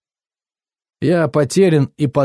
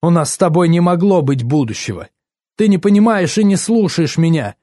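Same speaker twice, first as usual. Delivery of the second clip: higher-pitched indignant reproach; mildly heated, raised-pitch rebuke; changed, indignant tone; 6 semitones higher, 4 dB louder than usual. A narrator's reading. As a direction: turn from pleading to accusing. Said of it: "Она начала говорить другим тоном".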